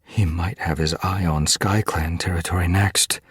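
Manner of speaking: usual speaking voice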